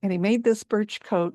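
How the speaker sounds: ordinary delivery, nothing marked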